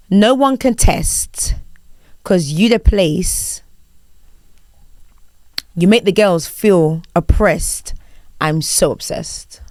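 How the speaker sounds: very slow, formal lyric reading. Shown as a demonstration. Host quizzing guest, formal spoken reading. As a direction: read song lyrics like an HR officer. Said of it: "such a formal H R voice".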